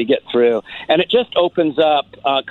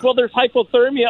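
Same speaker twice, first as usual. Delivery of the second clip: a touch higher, half-laughing reply; girl's reply, lightly voiced; 10 semitones higher than usual. Girl on the bridge answering the comedian, lightly voiced. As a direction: she pushes back, amused.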